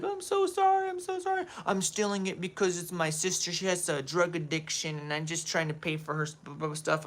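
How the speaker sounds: high, pleading put-on voice; mock girlfriend apology. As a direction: whine the apology like the caught girlfriend. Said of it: "she's like".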